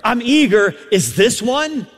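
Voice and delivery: high, forceful pulpit delivery; ringing sermon push; preaching. Real, not acted. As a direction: preach it out with lifted voice.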